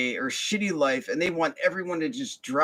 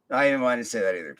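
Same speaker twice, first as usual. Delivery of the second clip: ordinary talk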